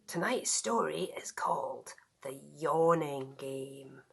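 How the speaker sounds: breathy bedtime whisper. Acted. Storyteller whispering the title to sleepy children. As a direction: hush it like the children are nearly asleep.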